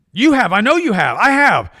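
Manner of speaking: loud and high, insisting; emphatic insistence. Real, not acted. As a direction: push it hard and loud. Insisting about addictions.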